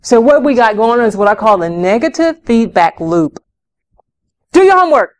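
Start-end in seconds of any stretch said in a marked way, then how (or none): 4.5-5.1 high loud command; barked order. Acted. Parent ordering child to do homework, loud sharp order.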